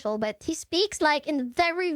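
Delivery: usual speaking voice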